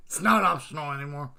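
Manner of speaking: words spilling out through laughter; laughing. Real, not acted. Streamer cracking up at chat, laughing loudly.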